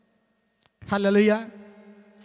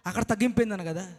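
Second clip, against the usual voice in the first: ordinary talk, nothing marked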